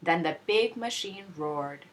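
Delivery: usual speaking voice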